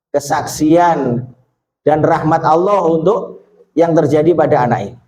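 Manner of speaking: usual speaking voice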